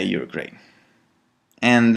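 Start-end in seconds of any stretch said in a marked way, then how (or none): 0.0-0.5 soft self-praise. Shown as a demonstration. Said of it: "look in the mirror and say".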